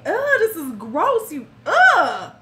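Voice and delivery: ugh, grossed-out brush-off; disgusted reaction. Retold or imitated. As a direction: push him away with disgust. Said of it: "It's like".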